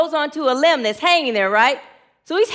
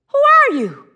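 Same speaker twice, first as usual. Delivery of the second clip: high, louder cry upward; startled question; 11 semitones higher, 5 dB louder than usual. Acted. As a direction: demand it in acted alarm.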